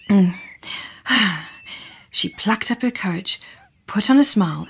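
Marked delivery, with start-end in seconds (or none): none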